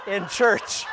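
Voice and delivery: laughing while speaking; words broken by laughter. Real, not acted.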